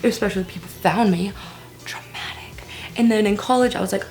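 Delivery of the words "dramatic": dry whisper; whispered aside. Pretend. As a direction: whisper it to yourself. Whispered self-comment.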